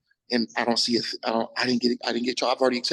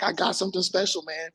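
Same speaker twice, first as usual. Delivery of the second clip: cocky boast; 7 semitones higher than usual. Retold or imitated. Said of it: "but I'm like".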